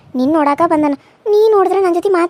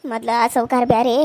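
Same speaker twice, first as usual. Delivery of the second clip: usual speaking voice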